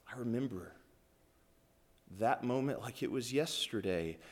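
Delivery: low, soft reverent preaching; hushed testimony. Acted. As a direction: sink into a quiet, remembering voice. Hushed telling of disciples' testimony.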